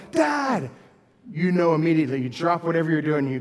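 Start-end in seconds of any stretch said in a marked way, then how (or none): 0.1-0.7 high, loud panicked cry for dad; child crying out for dad in an emergency; urgent yell. Shown as a demonstration. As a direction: yell it like something is seriously wrong. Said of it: "there's a cry that if they yell".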